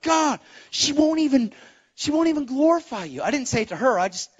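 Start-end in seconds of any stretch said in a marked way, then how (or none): 0.0-3.2 complaining to God. Retold or imitated. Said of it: "I'm like".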